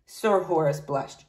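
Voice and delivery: ordinary delivery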